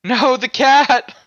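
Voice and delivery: high, loud, drawn-out cry of alarm; mock dismay. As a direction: cry out slowly, hammed-up. Mock dismay over cat in game.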